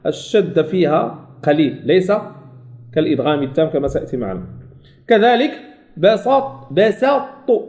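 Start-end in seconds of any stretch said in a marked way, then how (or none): none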